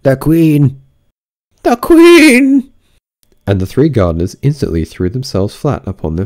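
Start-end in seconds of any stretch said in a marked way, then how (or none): 0.0-2.7 very high, loud alarmed cry; Five crying out the Queen is coming; calling out in alarm. Acted. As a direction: shout a warning across the garden. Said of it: "called out".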